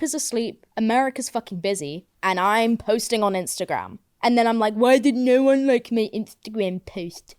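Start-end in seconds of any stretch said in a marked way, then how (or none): 4.7-7.3 mock your own needy complaint in a silly voice; mock whining. Mocking own complaint about post.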